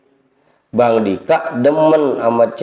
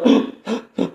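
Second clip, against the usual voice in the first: put-on a sharp high gasp for breath, like a sobbing child; quick gasping intake, half-mocking; imitated gasp; 10 semitones higher than usual. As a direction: mimic a crying child who can't breathe. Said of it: "Susah napas dia".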